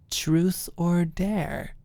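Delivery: slow, teasing offer; sly suggestion. Acted. Alicia slyly proposing truth or dare to Maud. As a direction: dangle it like a temptation.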